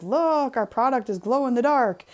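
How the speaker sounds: mock-excited product announcement; mock sales boast. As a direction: show off like a naive advertiser. Voicing the company announcing its product.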